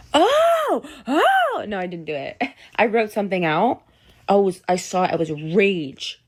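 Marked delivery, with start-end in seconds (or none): 0.1-1.6 very high, pretend-frantic "oh"; mock crazy gasp. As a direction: squeal like a crazed ex. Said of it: "be crazy and be like".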